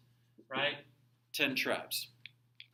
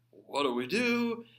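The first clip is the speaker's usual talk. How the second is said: higher, louder, slower frantic "What do we do?"; stretched, raised "What do we do?" like a scared king; panicked plea; 6 semitones higher, 5 dB louder than usual. Retold or imitated. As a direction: let the king beg in mock panic. King panics, asks Isaiah.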